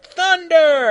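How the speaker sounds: high, drawn-out battle yell; yelled attack call. A narrator's reading. Krillin casting at Freddy, high loud yell. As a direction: yell it like unleashing a spell. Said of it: "waved his hand and yelled".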